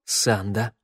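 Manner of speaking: a touch of a shaky whisper-call; trembling voice. A narrator's reading. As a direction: squeeze the name out, scared. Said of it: "дрожащим голосом выдавил Тео".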